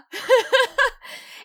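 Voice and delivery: loud giggle; high laugh. Real, not acted.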